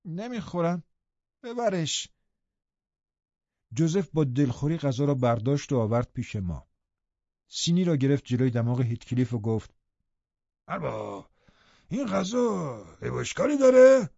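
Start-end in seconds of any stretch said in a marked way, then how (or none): 0.0-2.1 put-on sulky boy pushing the food away, rising higher; whiny refusal from a spoiled child; peevish. A narrator's reading. Sickly boy refuses the servant's porridge, peevish and higher. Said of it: "با کشخلقی گفت". 10.7-14.1 put-on old servant, sudden higher, louder question; indignant servant confronting the master; grumbling old servant. A narrator's reading. Joseph thrusts the tray at his master, indignant and louder.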